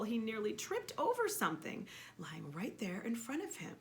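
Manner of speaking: ordinary delivery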